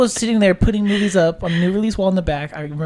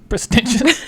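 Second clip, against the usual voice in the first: laughs at the misused word; laughing; 4 semitones higher, 4 dB louder, slower than usual. Real, not acted. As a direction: says Prestigious with a laugh. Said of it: "just pretentious".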